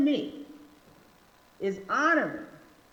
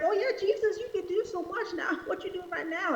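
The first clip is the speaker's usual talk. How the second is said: high, fast, laughing taunt; mocking crowd; 8 semitones higher, faster than usual. Preacher voices the crowd taunting Jesus, high and laughing. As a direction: jeer with a laugh. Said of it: "People are like".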